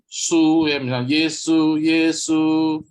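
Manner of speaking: SU held high and level, first tone; nada satu. Shown as a demonstration. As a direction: keep "Su" and "Yesu" on a high, even pitch. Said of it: "Nah, satu misalnya". ("Su" nada tinggi dan datar)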